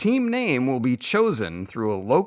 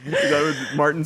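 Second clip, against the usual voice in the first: laughing; 3 semitones higher than usual. Real, not acted. Repeating the pun, laughing.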